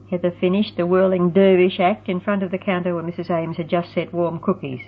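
plain reading voice

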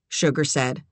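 ordinary delivery, nothing marked

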